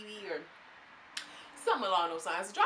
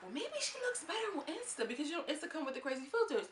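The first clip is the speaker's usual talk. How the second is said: mock skeptic; 8 semitones higher than usual. Mocking fan comment on Instagram.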